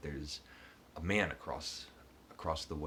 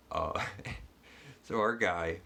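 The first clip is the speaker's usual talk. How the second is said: ordinary talk, nothing marked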